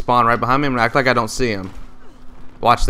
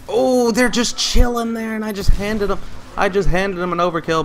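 exasperated; 9 semitones higher than usual. Real, not acted. Gamer whining after handing the enemy an overkill. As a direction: whine it out in disbelief.

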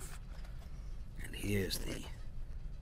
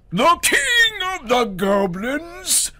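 rising to a roar; 13 dB louder, much slower than usual. Acted. Goblin King revealing himself to Herschel, building to a roar. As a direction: start creeping, end roaring. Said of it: "The voice rose to a hurricane roar".

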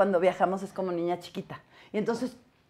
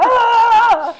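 a high, loud, shocked cry of alarm; high laughing yelp; shocked shriek; 14 semitones higher, 9 dB louder than usual. Retold or imitated. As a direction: shriek like a startled dad. Dad's shocked reaction, retold.

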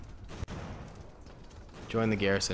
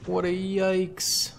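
higher, slow, put-on wail of yikes; mock dismayed voice; 9 semitones higher, much slower than usual. Gamer groaning at his troops in a mock voice. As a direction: stretch it out in a silly high whine.

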